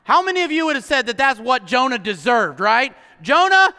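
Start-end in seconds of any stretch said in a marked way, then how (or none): 3.2-3.7 hammed-up divine threat; mock threat. Mock God threatening Jonah.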